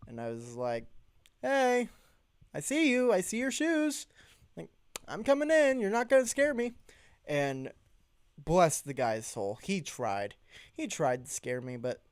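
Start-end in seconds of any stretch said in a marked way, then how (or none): 1.4-6.7 high, loud taunting holler; hollering at the clown hiding in the tent. Retold or imitated. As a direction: call into the tent, cocky. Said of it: "but hollered in".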